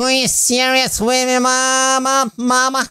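exaggerated high complaint; high mock whine. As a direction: whine it like a cartoon.

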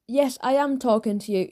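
ordinary delivery

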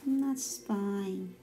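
guided meditation. Acted. Teacher walking children through a body scan, guided meditation. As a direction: lead them gently through the body, unhurried.

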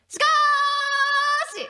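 しかーし with the middle vowel held very long and high; long drawn-out SHIKAASHI. Acted. Radio host reading a listener's letter, long drawn-out しかーし. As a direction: stretch しかーし into a big theatrical turn. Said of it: "長いね".

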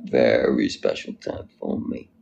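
slow, low delivery after a chuckle; low put-on voice. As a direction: drop it low and draw it out. Repeats catchphrase low, laughing.

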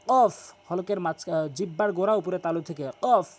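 normal talking voice

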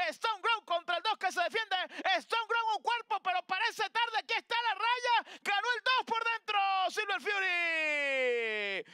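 excited. Real, not acted. Race finish, rising call.

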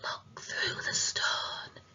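hushed, breathy ghost whisper; faint whisper. A narrator's reading. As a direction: whisper it so faint it's barely there. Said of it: "a voice so hushed".

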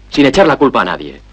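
ordinary talk, nothing marked

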